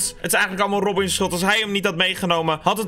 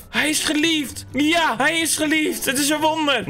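mock adoring; 6 semitones higher than usual. Mock tender talk to donkey.

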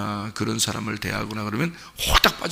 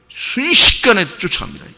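excited burst; 8 semitones higher, 6 dB louder than usual. Acted. Lion suddenly charges the straggler.

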